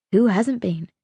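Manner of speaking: usual speaking voice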